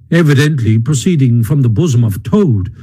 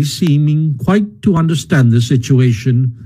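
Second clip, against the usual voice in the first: plain reading voice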